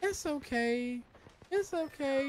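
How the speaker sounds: high, mocking it's-okay voice; mock reassuring woman. Mocking the women who say it's fine, high and sing-song. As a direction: parody someone brushing it off. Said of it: "I'm not finna be like".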